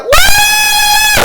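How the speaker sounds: very high, loud cheer; joyful whoop. Acted. King cheers that Daniel survived, high and loud. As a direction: let out a big happy whoop.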